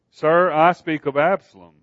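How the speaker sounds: ordinary delivery, nothing marked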